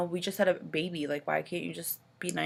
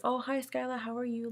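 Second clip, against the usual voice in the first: higher, softer sing-song greeting to a newborn; mother greeting her newborn daughter, higher and soft; baby talk; 7 semitones higher, 5 dB quieter than usual. Retold or imitated. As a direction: coo a hello to the baby in the hospital. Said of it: "Like, talking to the baby".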